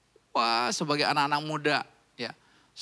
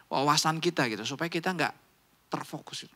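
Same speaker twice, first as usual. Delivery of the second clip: ordinary delivery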